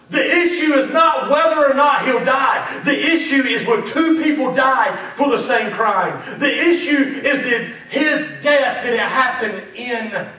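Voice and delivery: loud, ringing preacher cadence; preaching at full force. Real, not acted. Preacher thundering at the congregation, loud. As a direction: drive the point home to the congregation.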